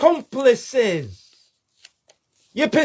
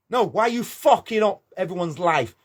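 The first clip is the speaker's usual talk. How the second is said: ordinary delivery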